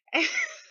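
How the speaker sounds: squealing laugh; high burst of laughter. Real, not acted. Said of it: "laugh after [name] bit".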